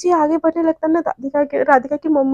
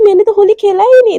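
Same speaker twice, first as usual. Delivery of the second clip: sulky complaint; 6 semitones higher, 7 dB louder than usual. Acted. Jay whining he missed Holi.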